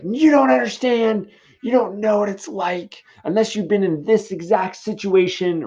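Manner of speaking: put-on high, loud protesting teen voice; mock the dumped kid who won't listen; whiny heartbroken teen. Teen snapping back at comforting parents, high and loud. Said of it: "your reaction might be like".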